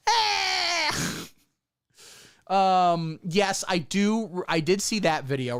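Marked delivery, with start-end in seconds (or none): 0.1-1.3 high yelp; mock startled yelp. Photobomber squealing behind him, high mock yelp. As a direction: squeal like you got caught in the photo. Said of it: "just going".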